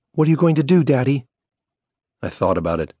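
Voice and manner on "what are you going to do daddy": put-on higher child's voice, rising in fear; a scared daughter pleading for answers; frightened little girl. A narrator's reading.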